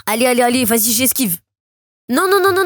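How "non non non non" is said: high, urgent string of no's; gamer's high-pitched panic; panicked no's. Real, not acted. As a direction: refuse it as health runs out. Nearly out of health.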